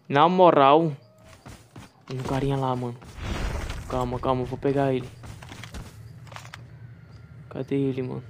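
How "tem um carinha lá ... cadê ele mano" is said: quiet. Real, not acted. Sneaking near enemy.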